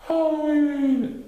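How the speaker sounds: high, slow, loud Pooh proclaiming; proud announcement. A narrator's reading. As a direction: announce it proudly as a little bear. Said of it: "announced Pooh".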